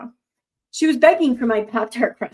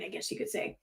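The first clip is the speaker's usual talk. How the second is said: breathy under-the-breath aside; whispering to herself, softer; whispered aside; 5 semitones lower, 15 dB quieter than usual. Real, not acted. Reading a post, she whispers an aside.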